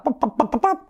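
high, quick chicken clucks; hen clucking. Acted. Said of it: "parece gallina, ¿verdad?".

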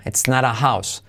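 usual speaking voice

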